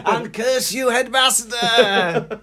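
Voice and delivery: high, drawn-out mock cry; hammed-up curse, stretching the title; melodramatic curse. Pupil cursing the school's head, melodramatic.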